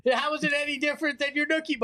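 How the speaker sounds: high mocking voice. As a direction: squeak it out mockingly. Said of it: "And then he goes".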